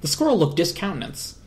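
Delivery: usual speaking voice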